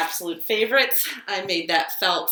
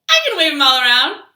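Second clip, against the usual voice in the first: louder and faster, higher, a burst of energy; excited fast line; 8 semitones higher, 12 dB louder, faster than usual. Acted. Librarian waving fingers with the toddlers, excited and fast. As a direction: zip through it loud like waving hands.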